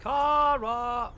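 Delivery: high, drawn-out call. Acted. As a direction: call out for a missing pupil. Professor calls for missing pupil.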